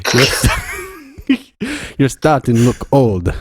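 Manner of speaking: badly faked Mediterranean accent, breaking into laughter; amateur table read in shaky Greek-Italian accent. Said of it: "Just do an Italian Greek".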